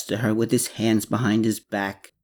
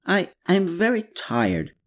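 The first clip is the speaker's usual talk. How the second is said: higher, mildly flustered stammer; slight stammer; 10 semitones higher than usual. A narrator's reading. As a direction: trip lightly on the first word, a touch uneasy. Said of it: "stammered while suddenly looking a little frightened".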